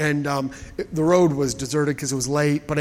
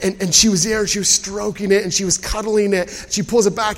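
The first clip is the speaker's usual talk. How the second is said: usual speaking voice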